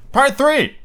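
high, loud chapter call; dramatic announcement. Acted. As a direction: announce the part with flair.

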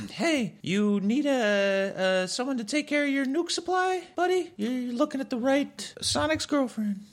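sidle up and sell yourself. Acted. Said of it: "and says".

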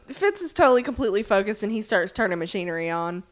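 ordinary delivery

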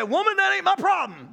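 curt, high mock-snub to his mother; dismissive retort. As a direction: toss it off like it's not your concern. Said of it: "I don't know if he said it with that kind of tone".

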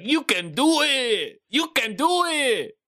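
high, slow, hammed-up cheer; cheering a friend on in a mock silly voice; Rob Schneider impression. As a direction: belt it out like an over-the-top movie sidekick. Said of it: "like the guy that's like".

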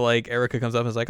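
usual speaking voice

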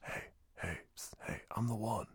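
hushed, sneaky test-time whisper. Retold or imitated. Keanu sneaking a question to her during the test, hushed whisper. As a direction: psst it under your breath to a classmate. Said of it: "And Keanu's like".

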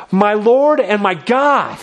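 Thomas suddenly seeing it; awed exclamation. Acted.

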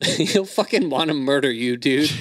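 ordinary talk

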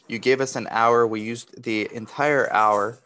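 ordinary talk

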